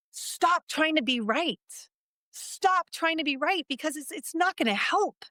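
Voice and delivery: rising, impassioned sermon-like appeal; heartfelt preaching. Real, not acted. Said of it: "you're taking us to church right now".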